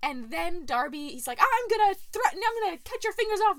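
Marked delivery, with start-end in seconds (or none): 1.4-3.6 higher and louder, rattled off fast; furious threat. Retold or imitated. King Brian threatens Darby, loud and furious. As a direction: spit the threat out like a raging little king.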